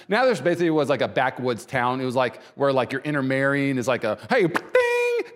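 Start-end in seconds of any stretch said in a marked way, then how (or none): 4.3-5.3 very high-pitched imitated ding; a quick "hey", then a squealed "ding"; mock twangy ding. Preacher mocking backwoods Nazareth, high comic ding.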